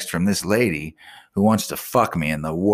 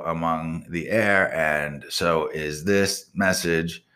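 slow, flat read-back of the garbled message; reading back the text; 4 semitones lower, slower than usual. Retold or imitated. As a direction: read it out slowly, word by word, like a machine transcript. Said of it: "so that message got fucking sent".

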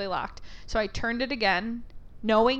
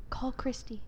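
whispered instruction to a coworker; imitated whisper; 8 dB quieter than usual. Coworkers conferring out of earshot.

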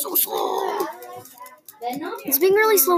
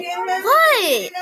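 surprised what; 6 semitones higher than usual. Real, not acted. Sudden problem in game.

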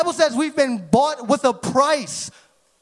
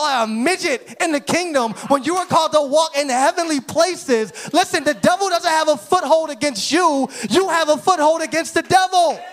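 raised, fired-up sermon shout; full-force preaching; 4 semitones higher than usual. Real, not acted. Preacher belittling the devil before congregation, full-force preaching. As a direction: drive it home to rouse the congregation.

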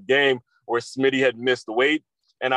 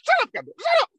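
furious yelling coach; faster than usual. Retold or imitated. Coach screaming at his son after kicking him, furious yell. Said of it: "He says".

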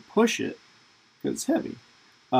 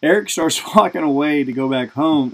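ordinary talk, nothing marked

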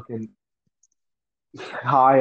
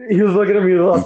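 fast, loud, high through a laugh; 6 semitones higher, 5 dB louder, faster than usual. Real, not acted. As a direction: blurt it out while cracking up. Laughs while retelling the stare.

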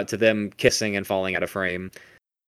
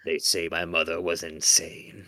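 dramatic audiobook reading; 3 semitones lower, 3 dB quieter than usual. Retold or imitated. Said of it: "audiobook of this would be fucking great".

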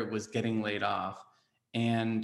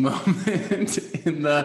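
laughing; 5 semitones higher, 8 dB louder, slower than usual. Real, not acted. Laughing about her tattoo urge.

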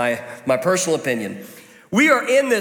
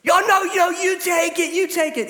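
much higher, overdone sugary insistence; fake politeness; 11 semitones higher than usual. Friend at table pushing the last piece away, higher exaggerated mock politeness. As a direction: gush the offer while secretly wanting it. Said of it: "Inwardly, you are wishing harm".

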